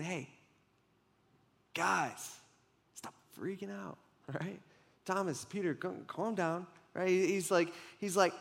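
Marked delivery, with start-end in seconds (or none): 0.0-7.1 much softer, calming as Jesus to his friends; soothing Jesus. Retold or imitated. Jesus calming Thomas and Peter, soft and reassuring. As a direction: hush them gently so they stop panicking.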